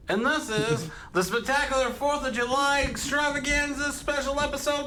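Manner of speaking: high, bright show opener; podcast host intro. Acted. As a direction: welcome listeners like a host, high and fast.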